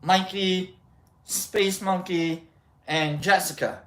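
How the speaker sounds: ordinary delivery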